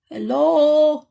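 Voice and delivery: loud call down into a well. Acted. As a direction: call out into the dark hole. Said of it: "called Percy".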